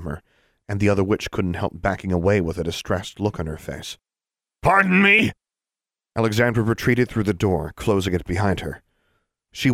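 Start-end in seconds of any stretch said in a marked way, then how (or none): 4.6-5.3 very high, loud put-on exclamation; posing as a man. A narrator's reading. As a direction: excuse yourself loudly after a bodily noise. Alexandra excuses herself after gaseous eruption.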